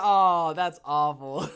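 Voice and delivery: slow, loud words through laughter; laughing. Real, not acted. Seeing himself on stream, laughing loudly as he speaks. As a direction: crack up at your own look. Laughing at his own face on camera.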